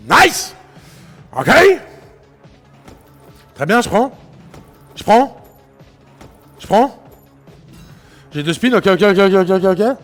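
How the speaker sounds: high, loud hype; rowdy party animal; excited shouting. Real, not acted. Hyped taking the win.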